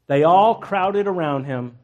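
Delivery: ordinary talk, nothing marked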